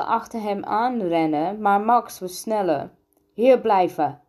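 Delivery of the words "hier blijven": loud, firm command; raised-voice order to stay put; called out. A narrator's reading. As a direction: call it out louder, as an order. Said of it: "riep hij".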